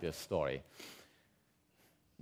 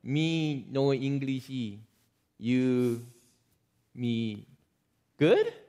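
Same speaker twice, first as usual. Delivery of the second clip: broken English; much slower than usual. Retold or imitated. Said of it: "we understand broken English".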